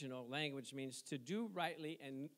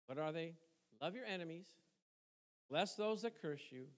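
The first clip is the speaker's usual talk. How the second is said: ordinary delivery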